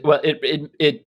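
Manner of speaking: usual speaking voice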